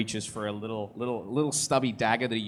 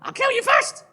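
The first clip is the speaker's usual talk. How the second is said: very high, loud furious screech; shrieked threat; 8 dB louder than usual. Acted. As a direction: scream the threat. Dryad threatening back.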